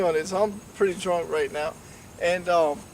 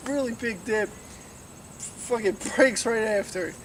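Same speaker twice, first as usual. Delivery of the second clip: normal talking voice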